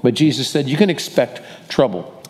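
ordinary delivery

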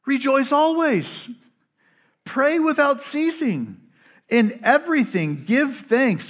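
slow, high-pitched verse declaration; emphatic scripture reading. A narrator's reading. Paul instructs a persecuted church, slow emphatic scripture reading. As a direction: proclaim each command with space after it.

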